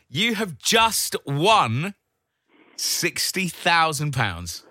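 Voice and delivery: drawn out, with a suspense pause; slow dramatic reveal. Real, not acted. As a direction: announce the prize slowly, building tension. Host reveals the prize amount.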